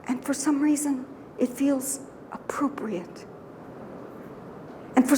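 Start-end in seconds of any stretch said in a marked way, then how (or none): 0.1-3.3 whispered. Real, not acted.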